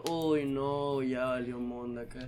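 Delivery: low, soft, slow groaning words; resigned dread. Retold or imitated. Barista realizes he must clean it, quiet resignation. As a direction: sink down quietly in defeat.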